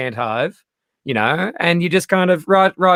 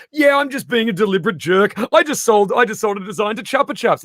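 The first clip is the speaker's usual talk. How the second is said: provocateur voiced higher, relishing it; gleeful troll; 7 semitones higher than usual. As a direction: gloat like a deliberate jerk. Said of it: "Dali comes and goes".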